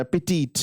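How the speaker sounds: ਪੈਟੀਟ said alone, ending in a long "teet"; correct ਪੈਟੀਟ pronunciation. Shown as a demonstration. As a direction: model ਪੈਟੀਟ with an "ee" vowel at the end. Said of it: "ਇਹਨੂੰ ਪੈਟੀਟ ਬੋਲਣਾ".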